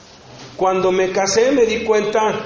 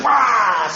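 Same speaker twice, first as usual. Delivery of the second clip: grito breve que imita una explosión; estallido imitado; 2 semitones higher than usual. Retold or imitated. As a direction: suelta un rugido de desborde, en broma. (estallido de ira imitado)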